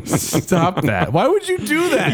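high squeal of protest; mock outrage. Real, not acted. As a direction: shriek it in mock horror. Mock outrage at hanging joke.

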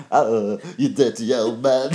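put-on a laughing, gruff old-man voice; Steptoe impression. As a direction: play the old rag-and-bone man. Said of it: "Harold Steptoe".

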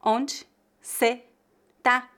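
"Onde cê tá" slowly, a pause after each word; word-by-word reduced forms. Shown as a demonstration. Teacher breaks down the reduction, "Onde cê tá" slow and separated. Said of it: "Veja que eu reduzi a palavra".

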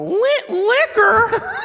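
high-pitched loud mock insult; high, hammed-up yell; mock insult shout. Quoting an insulting commercial.

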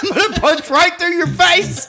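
high, loud threat through laughter; laughing mock threat. Re-enacting a punch threat to his friend, laughing. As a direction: play the drunken threat, cracking up.

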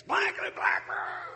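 squeaky nonsense cursing, high; mock profanity. The angry pedestrian cursing at the truck, as mock babble. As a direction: stand in for swearing, mock-yelled. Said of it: "he starts yelling profanities".

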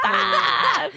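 short high yelp; startled high squeal; embarrassed yelp. Real, not acted. Embarrassed at her slip.

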